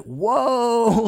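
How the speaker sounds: startled realisation said aloud; surprised whoa. Retold or imitated. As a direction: catch your breath at your own thought. Stunned by his own thoughts.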